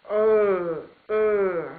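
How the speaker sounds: a tense, loud "Ö" drawn from the throat; back vowel demo. Shown as a demonstration. Teacher shows Finnish learners the Russian back i, loudly. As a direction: sound "Ö" with a pen between the teeth, loud. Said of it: "ja yrität sanoa i".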